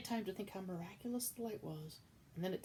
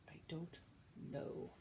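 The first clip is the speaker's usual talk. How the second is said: scared whisper; 6 dB quieter, slower than usual. Acted. Midge braces for a clout.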